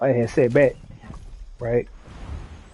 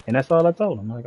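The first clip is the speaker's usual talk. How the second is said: ordinary talk, nothing marked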